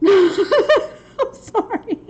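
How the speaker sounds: slow, high giggling "sorry"; laughing hard, slow and high; laughing apology. Real, not acted. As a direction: apologize through real laughter. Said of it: "I just spit".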